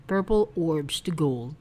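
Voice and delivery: ordinary delivery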